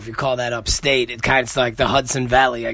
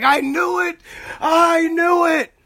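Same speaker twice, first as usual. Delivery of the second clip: much higher, slow drawn-out squealing I knew it; triumphant I knew it; 3 dB louder, slower than usual. Pretend. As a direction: gloat like she always suspected.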